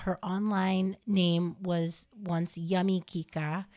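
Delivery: normal talking voice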